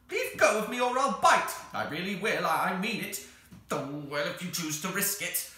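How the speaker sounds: loud, high, squawking threat; threatening creature. Acted. The held Psammead threatens to bite, furious.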